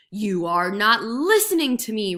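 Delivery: loud exasperated roar; roaring tiger. A narrator's reading. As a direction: bellow your frustration. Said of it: "roared the tiger".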